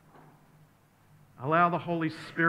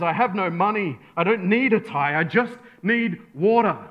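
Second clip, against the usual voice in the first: loud, high, acted exasperation; exasperated; 5 semitones higher, 8 dB louder than usual. Exasperated traveller refusing a tie seller, loudly fed up. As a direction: hammer out each need, at wit's end. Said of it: "The exasperated traveller says".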